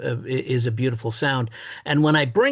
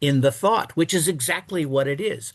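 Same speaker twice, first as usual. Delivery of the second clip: ordinary talk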